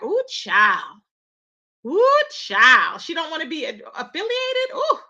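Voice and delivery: put-on drawn-out scandalised ooh child; hammed-up disbelief; mock shock. Retelling her own shocked reaction.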